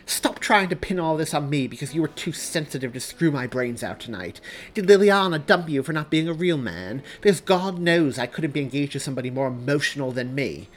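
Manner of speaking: loud, high angry tirade; heated, scornful accusations; disgusted. Acted. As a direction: lash out with disgust. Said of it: "amount of disgust she felt".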